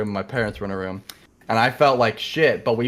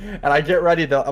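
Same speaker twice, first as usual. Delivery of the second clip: ordinary talk, nothing marked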